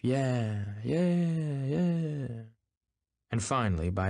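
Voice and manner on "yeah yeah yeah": drawn-out cheer, rising higher; cheering cries. A narrator's reading. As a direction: stretch each yeah like a distant crowd cry. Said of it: "followed by intermittent cries of".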